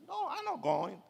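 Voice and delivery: high, soft mimicked refusal; sarcastic girlfriend. Girlfriend refusing Nikki, mock high-pitched sarcasm. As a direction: imitate her refusing in a small high voice. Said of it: "she was sarcastic".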